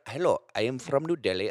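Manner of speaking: high, put-on Indian accent; caricatured Indian accent. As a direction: greet the crowd in an Indian accent. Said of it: "So I did an Indian accent".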